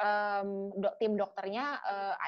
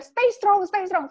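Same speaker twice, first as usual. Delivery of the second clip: much higher and louder urging; comforting boss; 10 semitones higher, 11 dB louder, slower than usual. Retold or imitated. Father's boss telling her to be strong, high and loud. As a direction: urge her on like a moved boss.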